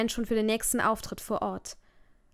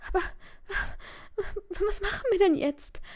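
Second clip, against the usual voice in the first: sobbing question, pitched far higher; crying girl asking her boyfriend what now; through tears; 11 semitones higher than usual. Acted. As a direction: choke it out between sobs. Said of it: "zwischen den Tränen und einem lauten Schluchzen".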